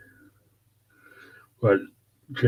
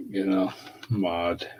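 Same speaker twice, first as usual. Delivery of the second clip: normal talking voice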